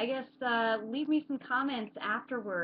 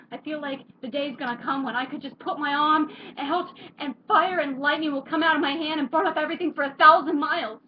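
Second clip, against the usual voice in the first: desperate, higher and louder, building to a shout; trapped in your own head, pleading to get out; tormented monologue; 7 semitones higher, 9 dB louder than usual. Acted. Character performing a stage monologue about being trapped, anguished and loud.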